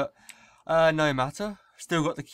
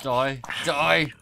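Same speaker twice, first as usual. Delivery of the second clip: shouting; 8 dB louder than usual. Real, not acted. Killing a mob in game.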